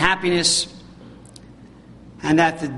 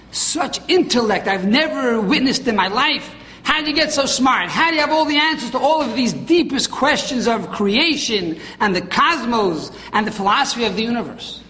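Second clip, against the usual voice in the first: higher-pitched amazed questioning; flabbergasted; 4 semitones higher than usual. Retold or imitated. Plato blown away by Jeremiah's answers, flabbergasted. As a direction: marvel at it, pitch lifted. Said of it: "Plato is flabbergasted. He's blown away".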